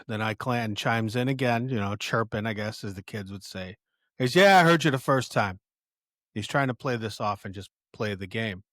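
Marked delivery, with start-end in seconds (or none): 4.4-5.6 mock snap. Ike Clanton snapping back at bar.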